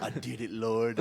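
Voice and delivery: laughing proud Peter. Pretend. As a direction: boast it through laughter. Voicing Peter proudly with ears.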